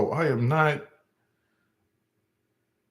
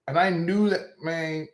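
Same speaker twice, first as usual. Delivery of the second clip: ordinary talk, nothing marked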